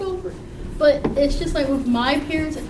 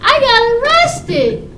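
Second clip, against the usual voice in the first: high slow confession; 10 semitones higher, 8 dB louder than usual. Retold or imitated. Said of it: "And I'm like".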